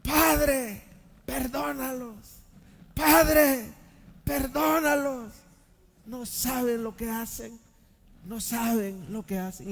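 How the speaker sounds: high, aching plea, slow at times; anguished cry. Acted. Jesus on the cross pleading for his killers, anguished. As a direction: cry out for their forgiveness in pain. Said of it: "Pero él gritó".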